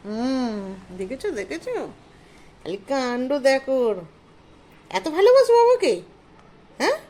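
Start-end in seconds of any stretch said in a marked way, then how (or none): 4.9-7.0 high, louder gushing question. Real, not acted. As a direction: gush over the pet. Doting on the dog.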